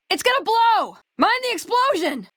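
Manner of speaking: high, urgent yell to take cover; shouted warning. Acted. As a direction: scream the warning over the blast. Warning of an explosion.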